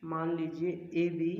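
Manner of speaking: slow, word by word, for writing down; slow dictation. Shown as a demonstration. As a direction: dictate "मान लीजिए" slowly so they can write. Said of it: "मैं आपको एक line लिखवा देता हूँ". (धीरे-धीरे लिखवाते हुए)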